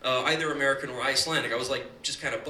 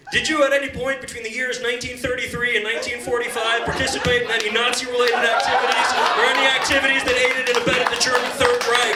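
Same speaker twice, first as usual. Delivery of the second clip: loud, higher deadpan officer voice; interrogating officer; 6 semitones higher, 6 dB louder than usual. Retold or imitated. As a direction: ask it with stiff bureaucratic gravity. Officer asks absurd Nazi question.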